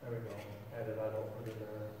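low, very soft mumble; muttered aside. Real, not acted. Preacher loses track for a moment, muttered aside. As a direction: mutter it to yourself under the breath.

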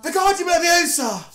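high, pompous spell-casting chant; mock Voldemort spell. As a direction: cast it like a dark wizard. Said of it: "I'm like Voldemort type shit".

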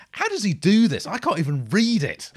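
high, loud disbelieving cry; stunned disbelief. Real, not acted. Reading fast rap lyrics, crying out high and loud in disbelief. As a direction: throw up your hands at the impossible lyrics.

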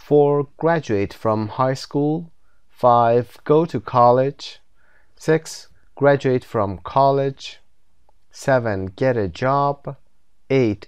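slow, deliberate reading of each step for learners; slow textbook reading. Shown as a demonstration. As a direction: read every item slowly, like a teacher dictating. Teacher reads textbook list.

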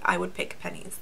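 whispered confession. Real, not acted. Whispering her honest vote for Penneys. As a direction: drop to a hushed secret admission.

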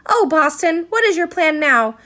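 loud, anxious appeal; urgent plea. Acted. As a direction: beg him for a plan. Mother pleading urgently.

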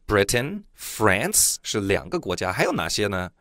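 ordinary talk, nothing marked

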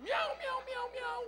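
high mock cat meows; meowing like a cat. Preacher mocks the congregation with cat meows. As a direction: meow it like a cat, with a laugh.

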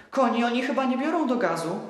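put-on a boy suddenly scared for his horse; high alarmed child cry; frightened child. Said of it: "spytał nagle przestraszony".

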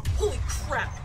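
high, loud shock; jump-scare exclamation; startled shout. Real, not acted. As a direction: blurt it out in fright. Startled in the game.

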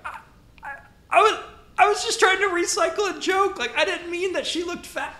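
high, squeaky nervous excuse; panicked squeak. Retold or imitated. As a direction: scramble to explain in a high panic.

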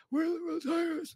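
out of breath. Pretend. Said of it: "you're so out of breath".

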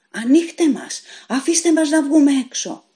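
high, loud desperate cry to be let out; prisoners howling. A narrator's reading. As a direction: yell it through the cell doors. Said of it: "να ουρλιάζουν".

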